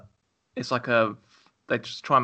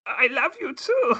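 much higher, slow put-on girl voice; Adrienne Barbeau sounding girl impression; 14 semitones higher, 3 dB louder, slower than usual. Guest performs the accented film girl on request. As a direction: coo it in a high, slow film voice. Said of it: "do you have one, an impression prepared?".

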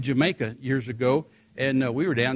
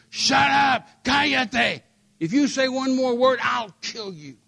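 high, loud threatening yell; mock gunman yelling, far louder; gunman's shout; 11 semitones higher, 6 dB louder, slower than usual. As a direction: bark it like a gunman with a pistol.

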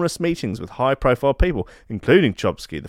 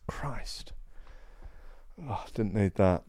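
whispered; 3 semitones lower, 14 dB quieter, slower than usual. Real, not acted. Shocked at names on list.

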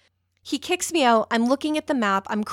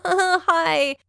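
awkward greeting; 7 semitones higher, 5 dB louder than usual. Retold or imitated. Flustered, has to greet her hero.

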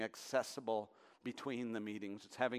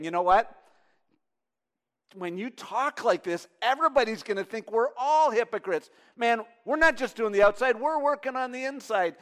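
protesting lawyer; 11 semitones higher, 15 dB louder than usual. Retold or imitated. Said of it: "he was saying".

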